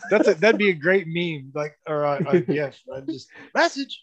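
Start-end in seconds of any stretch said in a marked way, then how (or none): 3.5-4.0 high-pitched movie catchphrase; Keenan Ivory Wayne. Retold or imitated. Meme reply to someone, high-pitched mock call. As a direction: drop in the gag, voice pitched way up. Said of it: "Keenan Ivory Wayne's always going".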